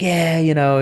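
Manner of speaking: Sam Zell. Retold or imitated. Curmudgeonly investor answering the European guy, mock curmudgeon. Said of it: "kind of a curmudgeon-y guy".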